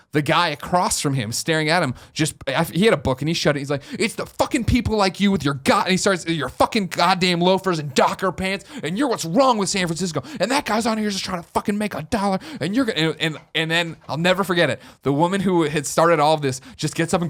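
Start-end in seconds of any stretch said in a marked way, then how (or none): 4.0-5.8 higher-pitched heated rant, not full volume; imitated angry yelling. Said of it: "this guy now yelling". 6.3-13.0 higher-pitched heated rant, not full volume; imitated angry yelling. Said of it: "this guy now yelling".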